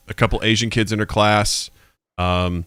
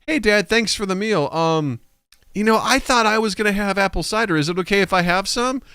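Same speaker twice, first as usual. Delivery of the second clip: put-on sweet polite child; 10 semitones higher than usual. Mom modeling polite words for daughter. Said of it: "Maybe a better way to say that is".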